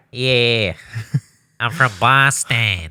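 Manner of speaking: slow, stuffed-nose Boston voice; "I'm from Boston" said through the nose; Boston accent. Shown as a demonstration. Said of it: "talk with a stuffed nose".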